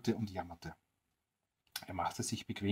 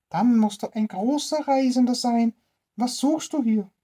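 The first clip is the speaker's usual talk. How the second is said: high, put-on old woman voice; old woman's voice; 13 semitones higher, 7 dB louder than usual. Old woman greeting the tramp at her door, high put-on voice. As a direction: answer like a wary old crone, pitched high.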